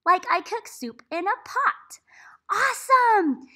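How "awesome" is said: excited praise. Real, not acted. Teacher praising child.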